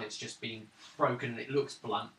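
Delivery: ordinary delivery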